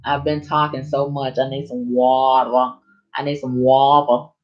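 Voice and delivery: ordinary talk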